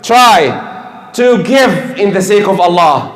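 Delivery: ordinary talk, nothing marked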